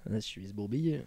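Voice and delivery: lightly put-on Monaco accent; a hint of Monaco accent. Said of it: "Pourquoi t'es venu sur un accent de monégasque".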